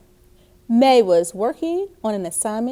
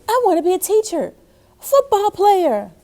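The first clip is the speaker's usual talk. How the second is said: put-on high, fast, louder kid; eager kid shouting dreams; excited child classmate; 9 semitones higher, 4 dB louder, faster than usual. A narrator's reading. A classmate telling Miss Bell his dreams, high and fast. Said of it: "Someone said".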